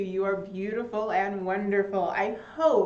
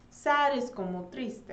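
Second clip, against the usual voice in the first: ordinary talk, nothing marked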